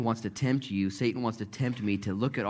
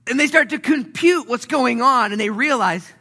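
loud, high, driving sermon delivery; full-force preaching; 10 dB louder than usual. Acted. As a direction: preach it out, building fast.

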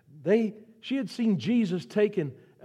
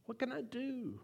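much softer, a lost aside; Mary asking herself, quiet and defeated; helpless murmur; 7 semitones higher, 13 dB quieter than usual. Retold or imitated. Mary at the tomb, to herself, soft and helpless. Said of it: "Think what must have been running through her mind".

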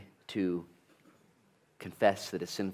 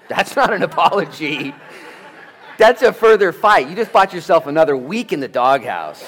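much higher and louder, laughing; laughing mock rebuke; 9 semitones higher, 15 dB louder than usual. Pastor playing the offended spouse, laughing and loud. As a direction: ham up the spouse's comeback.

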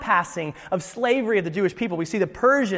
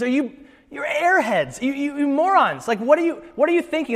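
high-pitched, incredulous berating of the council; exasperated scolding; 7 semitones higher than usual. Acted. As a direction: act out a priest scolding fools. Acts out Caiaphas's insulting dig.

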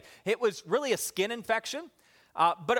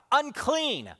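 high, drawn-out warning call; warning cry; 6 semitones higher, much slower than usual. Acted. Lepers warning anyone who comes near, acted yell. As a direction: call it out to keep people away. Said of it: "they would have to yell out".

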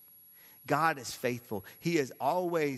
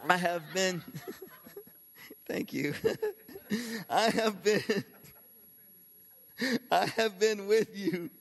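high voice broken by laughing; real laughter; 8 semitones higher, 4 dB louder than usual. Real, not acted. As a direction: laugh through the quote and restart. Preacher breaks into laughter while quoting.

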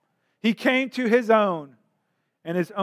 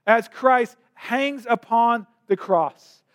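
ordinary talk

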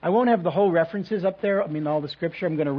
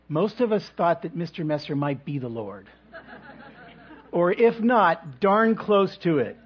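ordinary delivery

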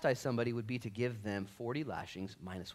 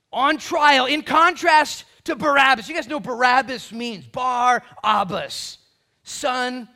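high, loud sermon delivery; impassioned raised preacher's voice; full-force preaching; 14 semitones higher, 19 dB louder, slower than usual. Acted. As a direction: proclaim it to the whole congregation.

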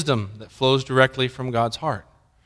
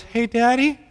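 slightly higher, a hint of small child; lightly voiced little girl; 5 semitones higher, 3 dB quieter than usual. Three-year-old daughter calls dad from back seat, lightly childlike. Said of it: "she was about three or four years old".

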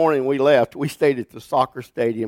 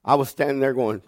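usual speaking voice

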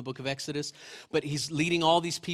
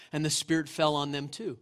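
ordinary talk, nothing marked

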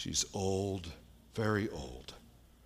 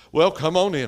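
higher, much louder imitated old woman; loud grudging invitation; 7 semitones higher, 12 dB louder than usual. Old woman to visitors wanting to pray, loud grudging call. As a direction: call them in loudly, as a concession.

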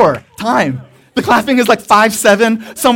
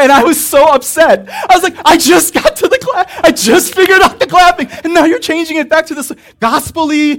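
mock exasperated; 8 semitones higher, 6 dB louder than usual. Retelling his frustration, louder.

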